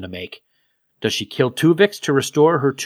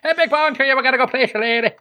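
much higher hammed-up Scottish brogue; 11 semitones higher, faster than usual. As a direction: camp it up in a Scottish voice. Playful Scottish voice bit.

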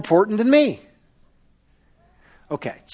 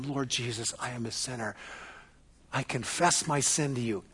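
soft, low prayer of confession; a whispered-close confession; hushed prayer; 7 semitones lower, 15 dB quieter than usual. Shown as a demonstration. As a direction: pray it quietly as if alone with God. Modelled quiet prayer of confession.

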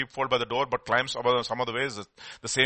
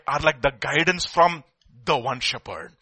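ordinary talk

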